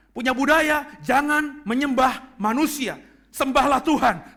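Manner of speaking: ordinary delivery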